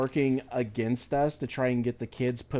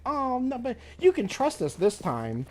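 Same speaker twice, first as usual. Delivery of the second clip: high, mocking sing-song caseworker voice; hammed-up pleading caseworker, pitched way up; 11 semitones higher than usual. Caseworker pleading for trust, high mocking imitation. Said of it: "they're like".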